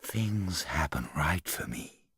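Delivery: slow, hushed whisper of wonder; awed whisper. A narrator's reading. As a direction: whisper it slowly, in awe. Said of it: "said Jane in a sort of awed whisper".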